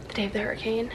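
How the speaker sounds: normal talking voice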